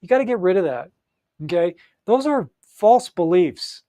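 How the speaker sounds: ordinary delivery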